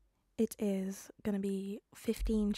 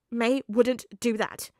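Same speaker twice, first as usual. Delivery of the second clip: louder, slow insistence; stubborn; 2 semitones higher, 8 dB louder, slower than usual. Acted. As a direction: dig in and refuse. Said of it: "Peter said stubbornly".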